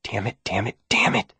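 soft hissed curse under breath; whispered. A narrator's reading. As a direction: whisper it so no one hears. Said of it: "I whispered as they vanished".

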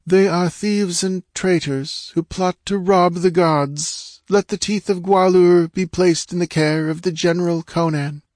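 high, measured oracle voice; false goddess's pronouncement. A narrator's reading. Woman posing as goddess repeats dictated words to priests, oracular. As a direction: intone the decree from on high. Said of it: "There was a quiver in her voice as she ended".

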